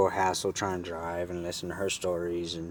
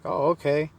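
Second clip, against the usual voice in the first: higher-pitched imitation of her; imitated woman; 8 semitones higher, 4 dB louder than usual. Woman accepts his offer, imitated higher. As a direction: raise the pitch for her reply. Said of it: "And she's like".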